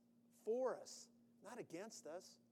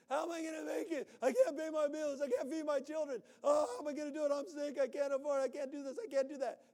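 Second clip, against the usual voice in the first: loud, higher, fast anxious rant; mock frantic worry; 6 semitones higher, 8 dB louder, faster than usual. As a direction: spill every worry at once. Worrier listing troubles in panic.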